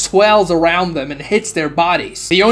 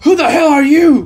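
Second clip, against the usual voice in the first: higher and louder, alarmed shout; startled demand; 8 semitones higher, 5 dB louder than usual. A narrator's reading. Captain challenging the masked stranger, alarmed shout. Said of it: "The older captain says".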